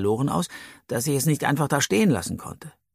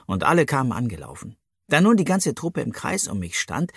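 ordinary delivery

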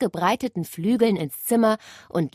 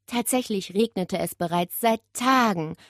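ordinary delivery, nothing marked

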